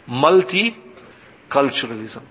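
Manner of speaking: key term drawn out slowly; "Multiculturalism" in two slow, spaced halves; slow, deliberate repetition. Real, not acted. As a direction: say it slowly, split after "Multi".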